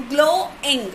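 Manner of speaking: "glowing" slowly as glow, ing; syllable by syllable. Shown as a demonstration. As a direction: separate the two beats of "glowing". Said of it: "ing is another vowel sound".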